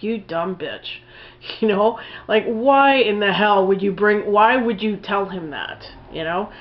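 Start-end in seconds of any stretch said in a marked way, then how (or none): 0.0-1.0 muttering it under her breath; a soft, scornful aside; quiet mock disgust at the screen; muttered insult. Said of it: "I was saying to myself".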